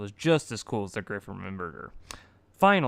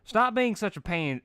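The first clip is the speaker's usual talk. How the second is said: early character voice; 10 semitones higher, 6 dB louder than usual. Acted. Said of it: "oh, I'm still reading".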